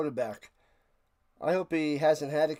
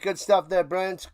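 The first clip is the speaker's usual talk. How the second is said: usual speaking voice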